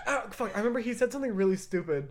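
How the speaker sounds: ordinary talk, nothing marked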